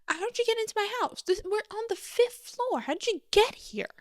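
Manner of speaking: high, fast, alarmed voice; panicked shrill questions. Retold or imitated. A woman finding a stranger in her flat, panicking high. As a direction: shriek the questions at an intruder.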